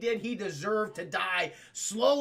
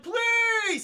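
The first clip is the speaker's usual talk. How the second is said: high, loud outburst; hammed-up pleading yell; mock exasperated plea; 13 semitones higher, 5 dB louder than usual. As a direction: beg the machine in frustration. Acting frustrated man at computer.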